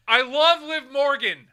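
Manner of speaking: high, slow, full-blast yell; shouted declaration. Pretend. Telling a friend of love for a wrestler, mock-earnest shout. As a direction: bellow the whole line in one go.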